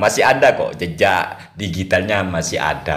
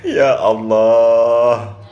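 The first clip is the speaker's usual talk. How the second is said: slow, louder, stretched Ya Allah; drawn-out exclamation; 3 semitones higher, 5 dB louder, much slower than usual. Real, not acted. Host reacting to the viral clip, drawn-out amused disbelief.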